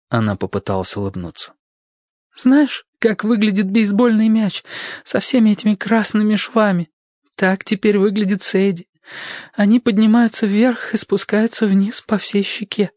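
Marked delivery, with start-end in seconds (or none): 2.4-12.9 high, hurt woman's voice, bitter; wounded young woman. Acted. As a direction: voice her bandaged and ashamed. Narrator voices Sadie.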